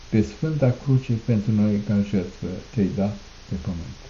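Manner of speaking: usual speaking voice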